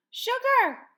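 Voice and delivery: sharp gasp then a high, loud cry of the name; gasped discovery. A narrator's reading. Hannah finding the lost dog under the bushes, gasping aloud. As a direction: gasp and cry out in amazed relief.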